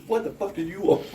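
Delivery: high, gruff retold greeting; hammed-up hostile shout; mock angry snarl. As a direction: bark it like a rude clerk. Retold rude greeting, loud.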